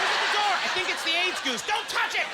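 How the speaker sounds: high, urgent warning; mock alarm. Householder warning family about goose at door, mock alarm. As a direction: call out in pretend panic.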